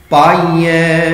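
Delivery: ਪਾਈਐ drawn out very slowly, nasal final ai; slow model reading. Shown as a demonstration. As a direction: stretch ਪਾਈਐ syllable by syllable. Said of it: "ਹੁਣ ਅਸੀਂ ਉੱਪਰ ਬਿੰਦੀ ਕਿਉਂ ਲਗਾਈ". (ਪਾਈਐ ਹੌਲੀ ਹੌਲੀ ਉਚਾਰੋ)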